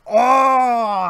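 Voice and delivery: sudden loud high oh of pain; pained grunt. Acted. Creature hit by pipe yelps loudly. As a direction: cry out as the pipe lands. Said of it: "is like".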